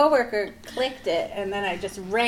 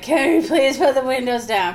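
retold yell; 5 semitones higher, 7 dB louder than usual. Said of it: "scream at the cab driver".

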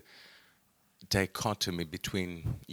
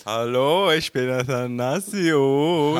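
high, loud, over-the-top hello; loud excited greeting; 7 semitones higher, 9 dB louder, slower than usual. Acted. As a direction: burst in with a loud, hysterical hello. Hysterical guest persona greeting loudly.

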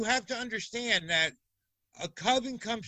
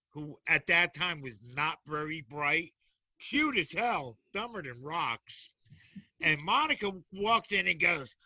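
ordinary talk, nothing marked

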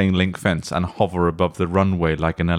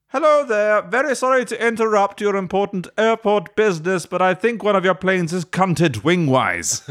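put-on a prim little drone boy asking politely; 12 semitones higher than usual. Drone boy politely tells the airport staff, high and prim.

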